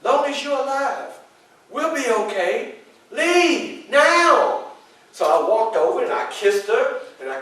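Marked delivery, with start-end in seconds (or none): 0.0-4.6 high, loud pleading, rising to a final push; calm her fears but hurry her along; urgent reassurance. Retold or imitated. Frederick urging his wife Anna to let him go: high pleading. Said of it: "But I'm like".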